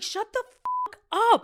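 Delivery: high, loud, drawn-out snap back; retold angry outburst. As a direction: stretch it out in exasperated mock fury. Retold heated quarrel with a stranger.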